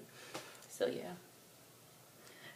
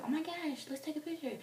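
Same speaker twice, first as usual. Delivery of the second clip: excited fans; 10 semitones higher, 3 dB quieter than usual. Retold or imitated. Retold fans asking for a picture.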